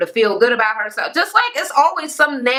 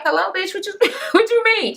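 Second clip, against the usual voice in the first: mock Sheree; 9 semitones higher than usual. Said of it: "Sheree like".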